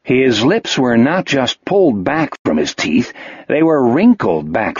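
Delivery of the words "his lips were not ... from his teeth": ordinary delivery, nothing marked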